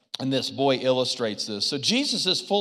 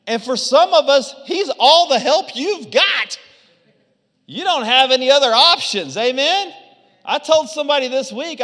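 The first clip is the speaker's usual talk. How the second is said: preaching at full force; 10 semitones higher, 8 dB louder than usual. Real, not acted. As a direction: drive it home to the congregation. Preaching climax.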